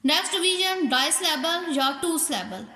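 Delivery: normal talking voice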